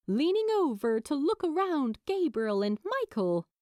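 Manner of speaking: ordinary delivery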